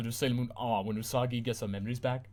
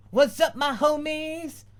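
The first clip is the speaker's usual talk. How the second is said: high, slow, drawn-out slangy greeting; cool dude voice; 5 dB louder, slower than usual. Acted. Brother entering the kitchen greeting his homies, slow and high. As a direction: swagger in like a wannabe gangster.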